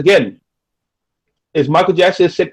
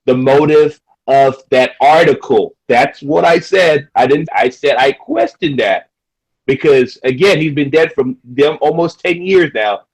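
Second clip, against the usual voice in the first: normal talking voice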